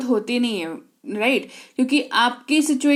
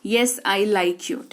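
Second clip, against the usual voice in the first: very slow, a little lower; slow model answer; 3 semitones lower, 4 dB quieter, much slower than usual. Shown as a demonstration. Teacher slowly repeats the answer for learners. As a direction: say it slowly twice. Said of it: "मैं आपको पसंद करता हूँ".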